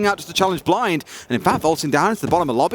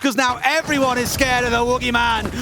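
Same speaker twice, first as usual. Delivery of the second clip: higher, lively hyped casting; excited commentary; 6 semitones higher than usual. Real, not acted. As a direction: keep the energy up after the play. Co-caster riding the hype.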